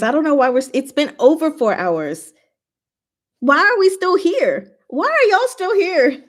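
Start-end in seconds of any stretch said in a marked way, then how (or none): 3.4-6.2 playful exclaim. Real, not acted. Teasing chat at four hours.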